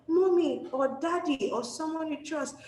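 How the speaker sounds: high-pitched appeal to a parent; child telling parent. Acted. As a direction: model a child speaking up, pitched well up. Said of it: "Just say it".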